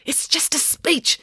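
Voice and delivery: high, slow, restrained hiss. A narrator's reading. Mayor scolds Dad on stage, hissing. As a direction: hiss it through gritted teeth. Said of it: "hissed the mayor".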